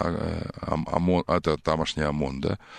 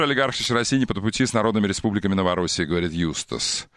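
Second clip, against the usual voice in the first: ordinary talk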